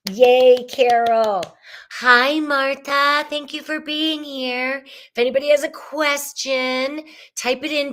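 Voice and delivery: high, loud cheerful welcome; bright livestream greeting. Real, not acted. Greeting viewers on her livestream, cheerful and high. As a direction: call out to each viewer by name with delight.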